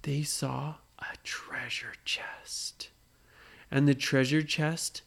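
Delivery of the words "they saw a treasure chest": slow hushed whisper of discovery; secret whisper. Acted. Parent to child at the big reveal, whispering. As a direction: breathe it like a secret. Suspense reveal in bedtime story.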